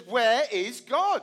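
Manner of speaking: staring pastor, pressing. Retold or imitated. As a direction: stare him down and ask again. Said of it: "kind of stares at him".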